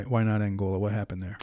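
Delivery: ordinary talk, nothing marked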